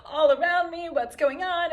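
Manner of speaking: high, loud, wailing mock child; tantruming child. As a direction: ham up a child crying that the world is ending. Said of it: "they're on the ground like".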